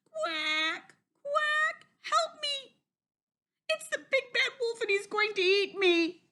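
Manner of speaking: very high, squeaky panicked duckling; high frightened little quacking voice; duckling crying for help. A narrator's reading. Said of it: "cried number five".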